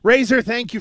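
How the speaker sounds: ordinary talk, nothing marked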